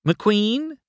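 high questioning call, rising at the end. A narrator's reading. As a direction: call his name as if searching. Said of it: "Sally called".

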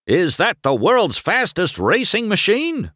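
high, hearty boom. A narrator's reading. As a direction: boom it out like a showy agent. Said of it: "boomed a familiar voice".

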